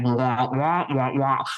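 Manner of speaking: muffled wah-wah of the Peanuts teacher; lightly voiced cartoon-adult babble. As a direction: mimic a muted trumpet droning on. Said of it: "The teacher from the Peanuts".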